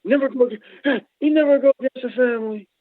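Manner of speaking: high put-on mobster voice; mobster line. Joking that the man should never go against the family, mock mobster. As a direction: quote the mob movie line with relish. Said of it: "Should've been against me".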